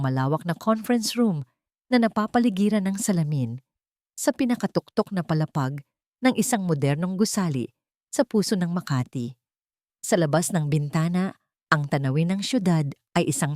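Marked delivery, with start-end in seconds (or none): none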